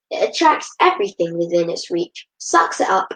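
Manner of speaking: usual speaking voice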